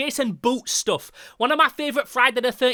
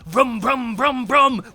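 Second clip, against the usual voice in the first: punchy repeated engine revs; chainsaw revving; 3 semitones higher, 6 dB louder, much slower than usual. Retold or imitated. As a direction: imitate a chainsaw being revved. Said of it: "sound of chainsaw revving".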